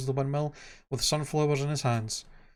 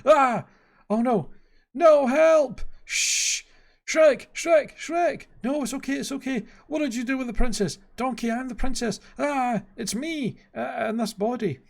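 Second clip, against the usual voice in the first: high-pitched panicked cries; frantic cartoon-style panic; freaking out; 10 semitones higher, 4 dB louder than usual. A narrator's reading. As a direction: shriek in alarm, voice way up high. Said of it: "Donkey starts freaking out".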